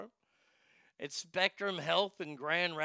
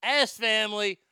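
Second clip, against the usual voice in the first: high, loud ringside intro; fight announcer; 7 semitones higher, 9 dB louder than usual. Acted.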